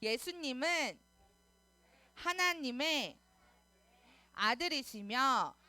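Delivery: slow chanted prayer in short phrases. Real, not acted. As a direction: lead the prayer phrase by phrase, stretching each ending. Leads children in repeat-after-me prayer.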